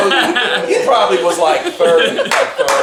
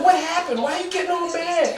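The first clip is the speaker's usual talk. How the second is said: higher, puzzled mom voice, lightly put on; imitating mom; 9 semitones higher, 6 dB quieter than usual. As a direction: play his confused mother, pitched up. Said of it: "she goes".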